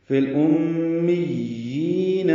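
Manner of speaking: فی الامیین chanted slowly with every ya held; slow tajweed. Shown as a demonstration. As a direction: draw out فی الامیین so the doubled ya stays clear. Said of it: "اسے جلدی نہیں پڑھنا".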